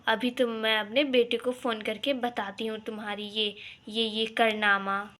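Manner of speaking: normal talking voice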